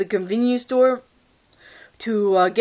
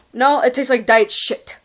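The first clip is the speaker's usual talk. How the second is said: usual speaking voice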